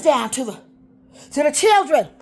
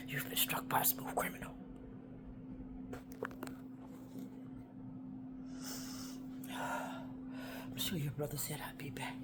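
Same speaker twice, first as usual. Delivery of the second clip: soft unvoiced whisper, much softer; 3 semitones lower, 15 dB quieter than usual. Real, not acted. Confiding to her auntie in a whisper. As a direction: whisper it close.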